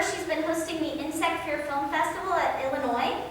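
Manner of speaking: ordinary delivery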